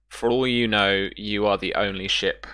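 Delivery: normal talking voice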